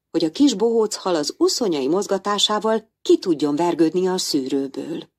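ordinary delivery, nothing marked

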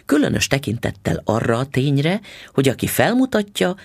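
ordinary delivery, nothing marked

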